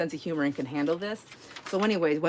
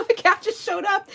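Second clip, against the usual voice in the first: much higher, mock innocent; innocent put-on voice; 13 semitones higher, 3 dB louder than usual. Telling the friend the cat just appeared, mock innocent. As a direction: sell the lie sweetly, pitched up. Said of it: "That was a lie".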